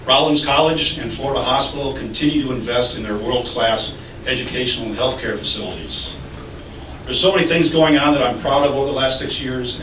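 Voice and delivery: ordinary talk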